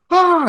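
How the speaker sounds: short, high-pitched imitated scream; mock freak-out scream. Host acts out Connor freaking out at the meeting, mock scream. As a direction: yelp as if panicking, without full volume. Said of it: "he just starts screaming and just freaking out".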